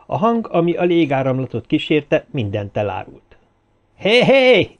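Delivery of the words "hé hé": magasan, hangosan kiált; kiálts fel messziről, hívogatva; kiáltás. A narrator's reading. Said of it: "kiáltotta egy ismerős orgánum". (hangos, magas kiáltás)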